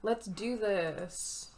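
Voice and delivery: usual speaking voice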